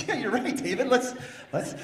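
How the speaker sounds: high, breaking into laughter; laughing reply. Real, not acted. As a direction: answer David while cracking up. Laughing at bitten warden.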